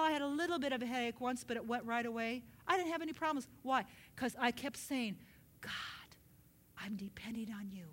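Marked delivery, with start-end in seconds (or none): 5.6-7.9 low, whispered reliance; soft, intimate plea; hushed prayer. Retold or imitated. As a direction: whisper it privately to God. Acts out private prayer.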